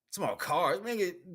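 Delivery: normal talking voice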